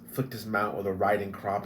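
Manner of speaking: ordinary delivery, nothing marked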